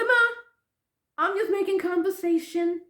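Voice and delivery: re-enacted exasperated daughter; defensive daughter. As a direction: protest defensively, pitched high. Defends herself to mother.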